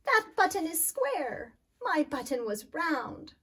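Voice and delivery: loud, high, wailing complaint. Acted. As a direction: wail over the lost button. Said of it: "wailed Toad".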